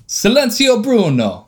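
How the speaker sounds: mock Italian exclamation, high start; hammed-up Italian accent. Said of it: "bad, bad Italian".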